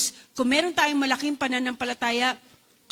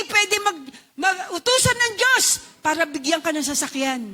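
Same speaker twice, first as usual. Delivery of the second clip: preaching at full force; 7 semitones higher than usual. Real, not acted. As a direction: preach it out with rising fervor.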